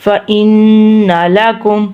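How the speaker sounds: "فإن" with n held through the nose; ghunna. Shown as a demonstration. Teacher showing sound from the nose, held ghunna. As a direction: hum long on the n of "فإن". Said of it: "ناک میں سے آواز نکالی ہے".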